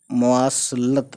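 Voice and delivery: मवासलत said slowly and clearly; slow careful pronunciation. Shown as a demonstration. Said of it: "तो ये word है मवासलत".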